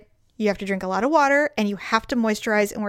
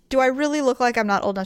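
ordinary talk

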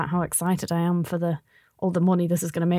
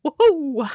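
high, zany "woohoo"; zany whoop; 7 semitones higher than usual. Acted. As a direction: let out a goofy high-pitched whoop. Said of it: "Make a zany noise".

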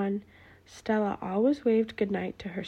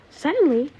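high, loud startling suddenly; dramatic suddenly; 5 semitones higher, 9 dB louder than usual. A narrator's reading. Narrator springing a surprise on listeners, loud and high. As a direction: jolt the listeners awake.

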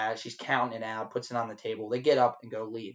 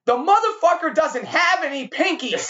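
high, loud, slowed screaming; 14 semitones higher, 5 dB louder than usual. Retold or imitated. Waiter screaming to his bar staff about the missing pinkies. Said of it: "I start screaming".

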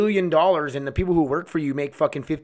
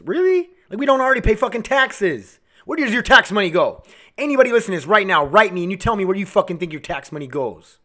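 incredulous, higher-pitched challenge; angry rant; 3 semitones higher than usual. Real, not acted. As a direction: challenge the listeners indignantly. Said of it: "I'm just angry right now".

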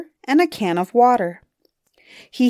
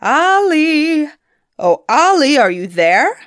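loud, high call over water; troll calling far across the water; hallooing across the bay; 7 semitones higher, 6 dB louder than usual. A narrator's reading. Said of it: "hallooing across to him".

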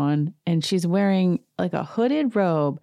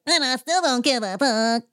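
higher mock defiant diva; defiant Erica; 8 semitones higher than usual. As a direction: toss it off defiantly. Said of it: "She's like".

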